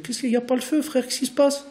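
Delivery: laid-back pupil. Retold or imitated. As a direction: brush it off like a teen who sees no rush. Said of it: "comme pour dire".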